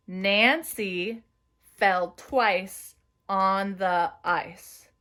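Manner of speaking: very slow sentence; slow dictation. Shown as a demonstration. Teacher dictating second sentence. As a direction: stretch each word for writers. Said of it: "Again with me".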